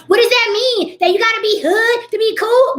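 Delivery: high, indignant mock question; mock offended commenter. Mimicking commenters twisting her words, indignant. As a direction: whine it like a touchy viewer. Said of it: "you guys like to misconstrue everything".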